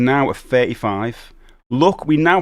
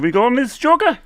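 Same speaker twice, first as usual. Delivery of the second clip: higher-pitched imitated question; acted-out customer; 11 semitones higher, 4 dB louder than usual. Retelling an advert, man asks for sugar, high acted voice. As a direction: act out someone asking, voice pitched up. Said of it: "And he's like".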